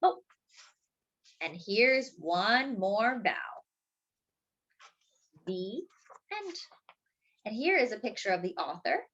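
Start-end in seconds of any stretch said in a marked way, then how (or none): none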